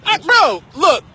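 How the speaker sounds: angry. Real, not acted. Arguing with security guard.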